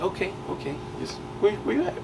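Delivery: a mother trying to stay calm on the phone; worried mom. Retold or imitated. Mom voiced calmly on phone.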